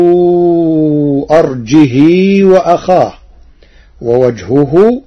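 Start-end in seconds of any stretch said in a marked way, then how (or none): 0.0-3.1 أرجه ending in a long kasra, arjihi; lengthened ha. Shown as a demonstration. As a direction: stretch the ha of أرجه into ee. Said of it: "بكسر الهاء مشبعة". (أرجه بهاء مكسورة مشبعة)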